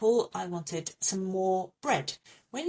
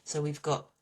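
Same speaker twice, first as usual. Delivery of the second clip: normal talking voice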